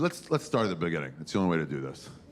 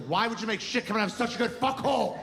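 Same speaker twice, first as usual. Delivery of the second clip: angry Adam; 11 semitones higher than usual. Acted. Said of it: "I made Adam so angry".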